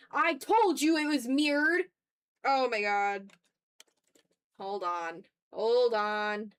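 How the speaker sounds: ordinary talk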